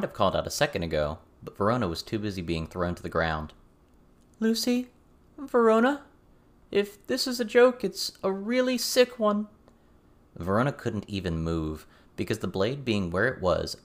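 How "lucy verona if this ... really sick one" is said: high, loud frightened calls; scared calling. A narrator's reading. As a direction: call for friends, growing scared. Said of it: "Avery sounded so scared".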